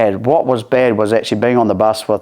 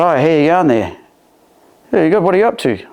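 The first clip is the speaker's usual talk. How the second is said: cheeky greeting; 7 semitones higher, faster than usual. Retold or imitated. Greeting the security guard cheekily.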